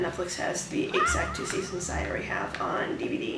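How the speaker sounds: soft sarcastic whisper. Real, not acted. Telling viewers of the letdown in a soft whisper. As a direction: breathe it out, deflated. Disappointed aside.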